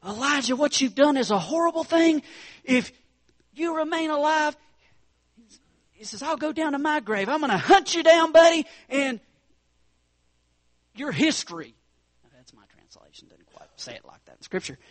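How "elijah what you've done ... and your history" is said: high, loud threat building to a yell; Jezebel's furious, high, loud warning; threatening queen. Retold or imitated. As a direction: hiss and then bellow a death threat. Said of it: "gets word".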